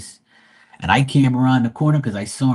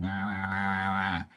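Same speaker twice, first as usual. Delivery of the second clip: softer, indistinct mock grumble; mock mumbling; 4 semitones lower, 9 dB quieter than usual. The drunk neighbour grumbling as he retreats, soft mock mumble. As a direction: imitate a drunk neighbour mumbling under his breath. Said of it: "he was mumbling".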